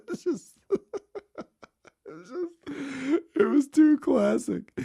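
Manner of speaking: words squeezed high through laughter; real laughter breaking the words; laughing. Real, not acted. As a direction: talk while cracking up. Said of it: "laughing through words".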